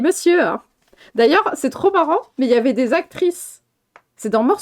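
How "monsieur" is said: high, mocking quote; mimicked quote. Amused at what the character said, high mock quote. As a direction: mimic the word in a raised pitch. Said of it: "il a dit".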